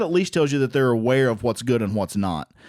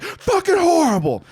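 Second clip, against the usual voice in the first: very high, strained blurt of fury; level ten upset; 4 dB louder than usual. Retold or imitated. Husband answering his wife's nice question, exploding in high-pitched upset. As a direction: explode at the top of your voice range. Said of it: "at a level ten upset".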